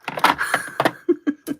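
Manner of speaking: short high, breathy laugh; real burst of laughter. Real, not acted. Laughs after quoting question.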